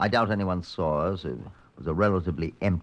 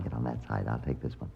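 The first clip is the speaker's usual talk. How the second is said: low, softer urgent instructions; hushed orders; 9 semitones lower, 7 dB quieter than usual. Acted. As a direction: keep it down, he's close. Hiding from approaching suspect.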